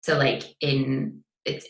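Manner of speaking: usual speaking voice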